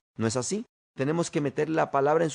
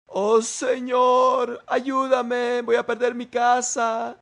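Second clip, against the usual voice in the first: high, loud, drawn-out mock wail; worried prayer; 8 semitones higher, 5 dB louder, much slower than usual. Worried believer prays over losing the house, wailing in mock anguish. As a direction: imitate a panicked plea to God. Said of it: "Nos ponemos preocupados en la oración y oramos diciendo".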